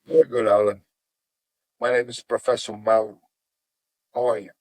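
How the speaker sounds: low, measured teacher voice; history teacher. Retold or imitated. History teacher introducing himself to a talkative new student, low and measured. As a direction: imitate a composed professor, pitched well down. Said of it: "He goes like this".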